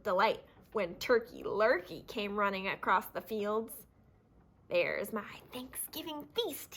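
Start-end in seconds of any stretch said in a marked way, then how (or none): 4.7-6.7 soft chuckle. Acted. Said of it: "he chuckled".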